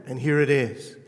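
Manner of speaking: ordinary talk, nothing marked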